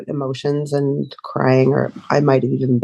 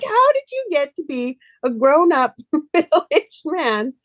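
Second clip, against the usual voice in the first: high-pitched mock incredulity breaking into laughter; laughing disbelief; 13 semitones higher than usual. Teasing a middle-aged friend in retelling, laughing disbelief. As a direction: laugh through an astonished question.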